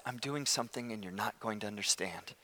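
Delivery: God's calm answer. Acted. God answering the protesting Habakkuk, calm and hushed. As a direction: drop to a quiet, low voice of authority. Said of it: "And God says".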